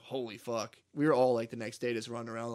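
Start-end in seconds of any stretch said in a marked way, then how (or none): 0.0-0.8 soft retold disbelief; stunned aside. Recalls sitting there stunned by hit.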